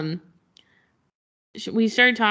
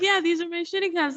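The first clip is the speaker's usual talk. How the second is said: amused confirmation; 10 semitones higher than usual. Retold or imitated. Her reply to fan.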